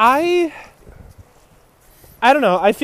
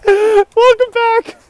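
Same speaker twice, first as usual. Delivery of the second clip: host voice; 4 dB louder, slower than usual. Pretend. Mock announcer welcome.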